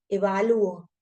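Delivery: normal talking voice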